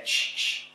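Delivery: unvoiced shushing; a quick whispered shh; mock shush. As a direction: hush them with a soft shh.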